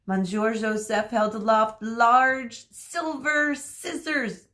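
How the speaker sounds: plain reading voice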